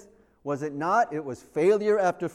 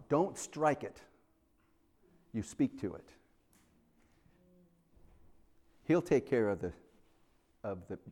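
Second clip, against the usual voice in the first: soft, low and patient; gentle patient reply; 5 semitones lower, 6 dB quieter than usual. Retold or imitated. God gently tells the Israelites to speak to the rock, soft and low. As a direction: calmly teach them about the rock.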